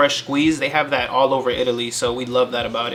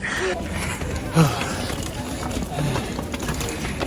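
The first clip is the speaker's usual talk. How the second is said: tired heavy sigh; 7 dB quieter than usual. Real, not acted. Tired after climbing the stairs.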